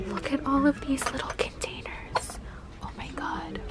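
very soft, breath-only wonder; amazed whisper. Real, not acted. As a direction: whisper as if awestruck by treasures. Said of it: "me whispering at all this amazing stuff".

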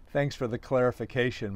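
ordinary delivery, nothing marked

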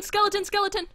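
sudden high, loud alarm; blurting out a warning. Real, not acted. Spots skeleton, warns friend.

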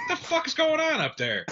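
high, incredulous complaint; baffled outburst. Retold or imitated. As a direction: ask it like you can't believe the noise.

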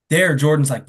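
ordinary delivery

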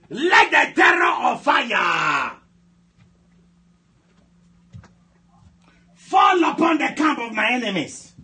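high, slow, drawn-out commands; full-force prayer shout. Real, not acted. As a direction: shout each phrase out long for repeating.

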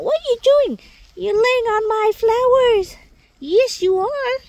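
high, loud sing-song pet voice; squeaky doggy talk telling them off. Real, not acted. Gardener teasing her dogs on flowers.

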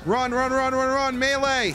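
high frantic panicked yelling; being chased. Real, not acted. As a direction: yell in a panic while fleeing.

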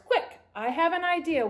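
plain reading voice

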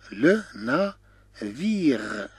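"لو نافير" slowly, a pause after each syllable; syllable-by-syllable demo. Shown as a demonstration. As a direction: stretch "لو نافير" out in three separate pieces. Teacher slows word for learners. (نطق "لو نافير" ببطء مقطعًا مقطعًا)

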